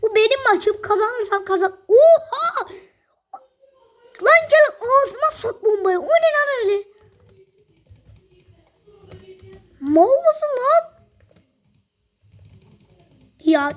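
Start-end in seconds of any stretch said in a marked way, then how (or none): 1.9-2.7 loud, high, laughing gasp of shock; a real startled laughing yell; shocked shout. Real, not acted. Shocked reaction. 4.2-10.9 excited yelling. Real, not acted. Yelling at an opponent.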